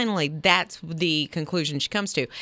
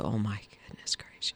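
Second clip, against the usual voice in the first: low, hushed whisper; exasperated whisper; 11 semitones lower, 10 dB quieter than usual. Real, not acted. Host recalls the noisy Elmo toy, exasperated whisper. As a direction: whisper it under your breath.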